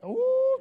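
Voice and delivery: long high-pitched whoop; high "ooh". Real, not acted. Preacher reacting to great timing, high ooh. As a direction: let out a high, held ooh. Delighted reaction to well-timed response.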